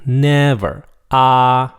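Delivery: NEVER and AH high, long and even; high flat tone. Shown as a demonstration. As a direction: hold NEVER and AH loud on a high level pitch. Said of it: "It's a high flat tone".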